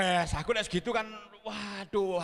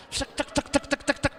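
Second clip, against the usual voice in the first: cek cek ticked out, higher and louder; imitated counting sound; 8 semitones higher, 4 dB louder than usual. Pastor imitating the ATM counting the cash. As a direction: tick off the notes like a machine.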